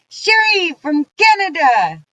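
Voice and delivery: loud, high call-out. Real, not acted. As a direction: sing out the name. Calling out a viewer's name.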